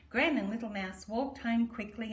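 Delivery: usual speaking voice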